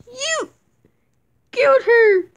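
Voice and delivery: high, loud shout; shocked accusation. Acted. Onlooker shouting at the killer, high and loud. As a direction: scream it in disbelief.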